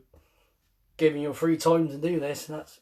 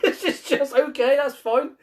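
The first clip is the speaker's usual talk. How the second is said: laughing; 10 semitones higher, 5 dB louder than usual. Real, not acted. Laughing at penis drawing story.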